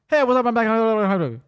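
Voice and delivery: loud, high, run-together jabber; garbled drive-thru speaker. Retold or imitated. Drive-thru worker returning to the customer, garbled speed-talk. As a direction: slur the whole greeting into one blur. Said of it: "the guy talks like that".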